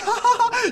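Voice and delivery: very high burst of laughter. Real, not acted. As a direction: cackle at how absurd she was. Laughs at her nerve.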